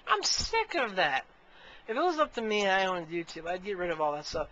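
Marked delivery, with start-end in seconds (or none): 0.1-1.3 fed up. Real, not acted. Ends rant about feeders, fed up.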